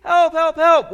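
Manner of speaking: high, loud cries for help; screaming for help. Retold or imitated. A sailor in the sea yelling for rescue. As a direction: call out desperately from the water. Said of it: "in the water screaming for help".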